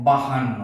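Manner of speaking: বাহান্ন as "ba-han-no", h sounded; correct h form. Shown as a demonstration. Teacher offering the second right form, বাহান্ন with sounded h. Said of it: "দুটি সঠিক".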